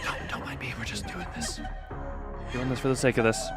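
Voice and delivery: ordinary talk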